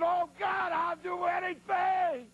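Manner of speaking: high, pleading cry to God, dragged out; desperate prayer. Retold or imitated. Trapped preacher begging God in panic. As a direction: beg God to get him out.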